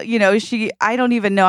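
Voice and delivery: ordinary talk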